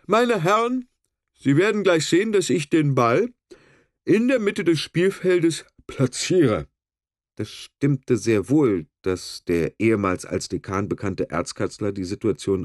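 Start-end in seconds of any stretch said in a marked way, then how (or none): 0.1-6.7 pompous referee. A narrator's reading. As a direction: savour every word pompously. Referee savours announcing.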